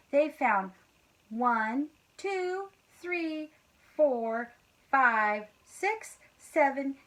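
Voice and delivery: lively storybook read-aloud; reading to children. A narrator's reading. Teacher reading a farm story to kindergarteners, lively. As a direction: read it brightly to a class of kids. Reads picture book aloud.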